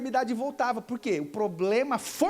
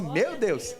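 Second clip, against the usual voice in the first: ordinary talk, nothing marked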